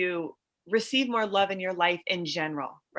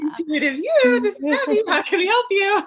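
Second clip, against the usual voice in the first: cheery phone greeting; 9 dB louder than usual. Pretend. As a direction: answer like a bubbly radio host. Answering the call on air.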